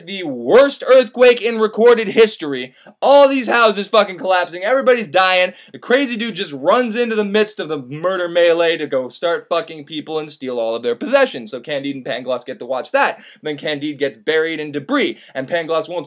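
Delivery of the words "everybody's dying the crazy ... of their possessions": ordinary delivery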